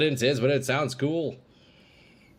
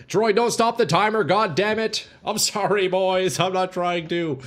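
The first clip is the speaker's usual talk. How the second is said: high, raised voice, exasperated; exasperated outburst; 8 semitones higher, 5 dB louder than usual. Real, not acted. Streamer apologising to viewers for stopping the timer, exasperated. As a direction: scold yourself loudly, then plead an apology.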